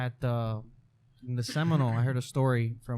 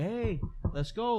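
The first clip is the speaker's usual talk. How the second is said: calling out; 9 semitones higher, slower than usual. Retold or imitated. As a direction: lightly act out calling to someone. Retold calling out into bathroom.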